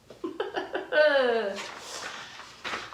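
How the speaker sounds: real amused laughter. Real, not acted. Choir leader laughing at a rehearsal mix-up. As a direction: laugh at your own slip.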